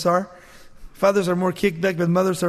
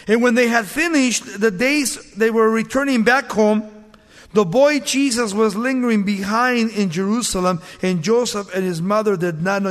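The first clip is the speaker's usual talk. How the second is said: raised, ringing Bible reading; scripture reading; 3 semitones higher, 4 dB louder than usual. Acted. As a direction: read the passage out to the congregation. Reads scripture aloud.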